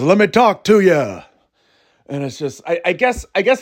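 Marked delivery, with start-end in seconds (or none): 0.0-1.2 loud, higher-pitched wrestler entrance line; hammed-up promo opener; LA Knight. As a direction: call it out like a wrestler to the crowd. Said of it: "he comes out and he goes".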